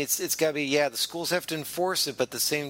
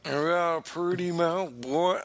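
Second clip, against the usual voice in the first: higher repeat of the movie hillbilly line; mock menacing hillbilly, a touch higher; hillbilly echo; 5 semitones higher than usual. Host echoing the quoted hillbilly line back, mock menacing. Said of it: "the quote where he said".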